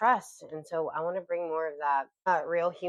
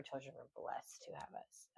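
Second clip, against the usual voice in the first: whisper; 2 semitones lower, 18 dB quieter than usual. Real, not acted. Tender aside about their children.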